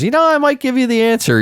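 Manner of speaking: very high, fast taunt; teasing. Acted. Dangling an answer in front of someone, teasing. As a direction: tease in a quick, high sing-song. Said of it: "suddenly somebody teases you and says".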